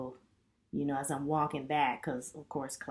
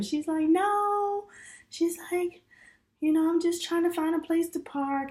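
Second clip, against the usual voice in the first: put-on high young woman voice; 14 semitones higher, 7 dB louder than usual. Young lady laughing it off.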